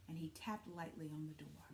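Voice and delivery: plain reading voice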